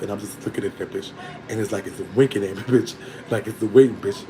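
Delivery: normal talking voice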